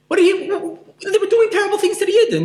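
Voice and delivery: high, half-laughing outrage; indignant disbelief. Acted. Preacher asking why Hashem spared Amalek, high laughing disbelief.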